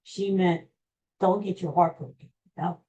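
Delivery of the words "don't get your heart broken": ordinary talk, nothing marked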